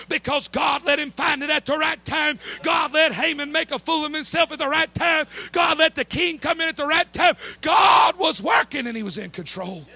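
loud, building preacher's cry; full-force preaching. Acted. Preacher thundering to the congregation about God's hand. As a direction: preach it to the rafters.

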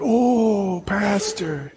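drawn-out "oh" dropping to a whisper; mock-reverent hush on the last word; imitated group awe. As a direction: play an awestruck crowd, trailing into a whisper. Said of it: "And they all went".

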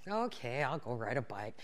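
low, soft, half-hearted imitated friend; reluctant agreement. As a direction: go along with it, voice dropped low.

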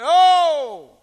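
loud, high imitated "Oh!"; mock cry of pain, loud and high; pained yell. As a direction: yell out like a thumb just got hammered. Acts out man hitting his thumb.

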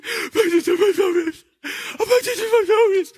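put-on high, small kid's voice; little boy voice. Son mumbling his words to dad, little boy voice. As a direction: play the little son earnestly.

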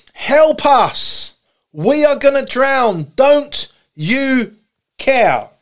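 desperate shout, dropping on the last word; acted screaming plea. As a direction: call out as if drowning. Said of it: "screaming, shouting".

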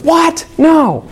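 high, startled what, no; shocked disbelief. Retold or imitated. Mrs Schwartz told her son said it, high mock shock. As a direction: gasp it out, pitched well up in mock shock. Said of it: "And Missus Schwartz says".

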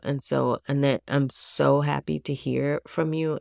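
usual speaking voice

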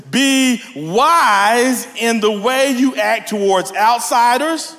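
high, slow preacher's reading; emphatic scripture reading. Acted. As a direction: stretch out the verse with emphasis. Reads scripture aloud.

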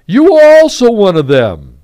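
ringing, high-pitched accusation; loud accusation. Acted. Accuser in dramatic Bible reading.

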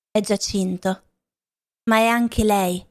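usual speaking voice

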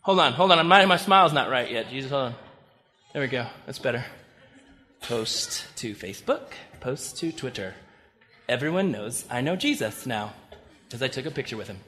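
hammed-up disciple fussing over a photo with Jesus; quiet mock selfie with Jesus, all for show; mock selfie-taker. Pastor acts selfie with Jesus.

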